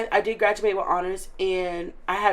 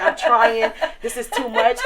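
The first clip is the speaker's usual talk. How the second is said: laughing; 3 semitones higher, 6 dB louder than usual. Retold or imitated. Retelling her old complaints, laughing.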